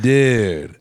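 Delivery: loud, drawn-out "dude"; mock rocker dude. A man blending in with Led Zeppelin fans, loud mock rocker. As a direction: stretch it out like a rock fan. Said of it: "somehow a Led Zeppelin shirt got put on me".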